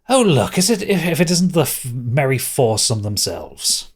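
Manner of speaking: high opening, falling at the end; mocking prince. GM voices prince's greeting.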